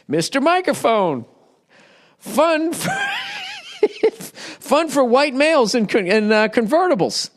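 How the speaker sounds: high, shouted cheesy jingle read, breaking into a laugh; hammed-up commercial pitch; mock ad announcer. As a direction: sell it like a seventies TV ad.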